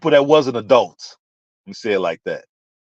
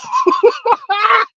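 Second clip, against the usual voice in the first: high laugh. Real, not acted. Laughing at own psychic prediction.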